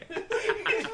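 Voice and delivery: loud squealing laugh; sudden high-pitched laugh; high burst of laughter. Real, not acted.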